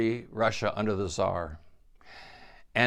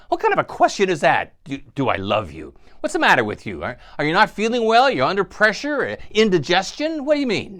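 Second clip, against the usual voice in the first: loud, fast, indignant retort, pitched up; angry retort; 6 semitones higher, 5 dB louder, faster than usual. Retold or imitated. Goldie snaps back at her husband, loud and indignant. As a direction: hurl the questions back at him, offended. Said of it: "angrily and says".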